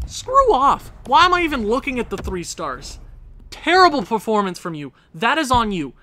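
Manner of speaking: loud, high-pitched angry yelling; furious outburst. Real, not acted. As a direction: explode at the goalie and blame him. Raging at his goalie.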